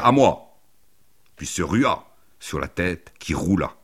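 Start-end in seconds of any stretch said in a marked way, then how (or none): 0.0-0.6 loud, high call for help; raised, high-pitched shout; cry for help. A narrator's reading. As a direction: call out urgently for aid. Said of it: "et cria".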